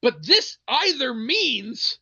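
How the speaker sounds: high, slow, drawn-out point; emphatic retold argument. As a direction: spell it out in mock exasperation.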